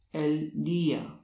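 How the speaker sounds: "el día" said slowly with a long stressed í; teacher's example word. Shown as a demonstration. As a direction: lean on the í. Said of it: "akcent graficzny".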